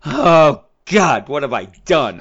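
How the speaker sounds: loud hammed-up exasperation; mock despairing groan. Atrocitus despairing at his dim lanterns, hammed-up exasperated cry.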